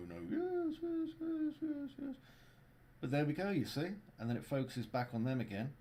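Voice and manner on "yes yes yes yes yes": much higher squeaky toy voice. Pretend. Voicing arguing furniture toys.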